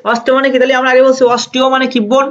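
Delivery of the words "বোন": usual speaking voice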